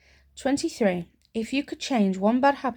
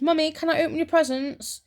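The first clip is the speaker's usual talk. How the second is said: put-on higher, lightly childish asking; sweet child asking; 8 semitones higher than usual. Young self asking mum about her presents, childish. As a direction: ask innocently like a little girl. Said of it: "And I said".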